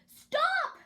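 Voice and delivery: high, loud shout of stop. A narrator's reading. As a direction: shout to halt her at once. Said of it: "Gertie shouted".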